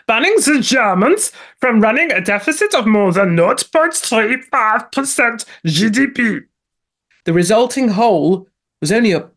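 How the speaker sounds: hammed-up American delivery; mock American accent. Host slipping into a mock American accent reading news. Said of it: "Don't know why we've gone American".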